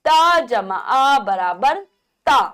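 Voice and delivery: very slow, each part held apart; slow spelling out. Shown as a demonstration. Teacher slowly spelling a syllable for children. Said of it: "जिसकी मात्रा खड़ी पाई".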